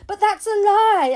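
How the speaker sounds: loud high outburst of protest; cried out. A narrator's reading. Child cries out at a lie about her. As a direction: cry it out, hurt and indignant. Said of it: "I cried out".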